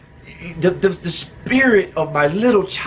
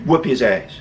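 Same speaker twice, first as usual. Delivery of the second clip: low, goading inner voice; little inner voice; 7 semitones lower than usual. Retold or imitated. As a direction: play the tempting voice in your head. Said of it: "that little inner voice gets to me and says".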